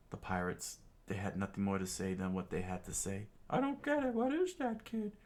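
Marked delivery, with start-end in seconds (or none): 3.5-5.1 very high cartoon pirate; high squeaky voice. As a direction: squeak it out in shock. Pirate voice, stunned.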